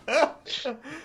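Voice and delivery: high, squeaky burst of laughter; high giggle. Real, not acted. Panelist cracking up mid-answer, high giggle. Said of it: "Why are you laughing at me".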